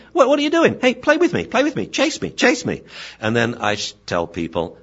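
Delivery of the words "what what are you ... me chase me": high, eager puppy voice; playful dog. Acted. Dog begging a friend to play, high excited voice. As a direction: squeak it out, begging to be chased. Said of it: "comes running up, saying".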